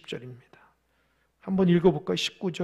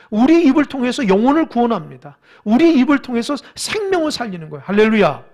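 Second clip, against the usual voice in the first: loud, rising sermon declarations; full-force preaching; 4 semitones higher, 7 dB louder than usual. Real, not acted. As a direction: drive each declaration home with a loud hallelujah. (힘주어 외치는 설교)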